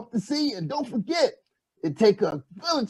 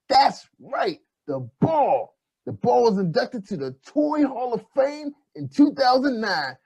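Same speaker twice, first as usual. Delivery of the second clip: ordinary talk, nothing marked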